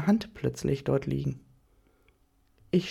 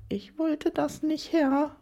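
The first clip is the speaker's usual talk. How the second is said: much higher, slow whimpered plea; whimpering plea; 10 semitones higher, slower than usual. A narrator's reading. Bound woman pleading to her master, high whimpering. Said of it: "wimmere ich".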